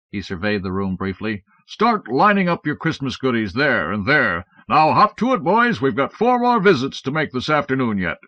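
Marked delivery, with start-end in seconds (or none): 1.7-8.2 higher, loud foreman orders; bossy Santa. Acted. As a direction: drive the crew like a foreman in a Santa suit. Santa bossing his crew.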